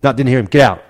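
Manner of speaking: retold star refusing a teammate, mock angry; imitated angry refusal. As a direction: shut the plea down with a mock bark. Incy dismissing the teammate's plea.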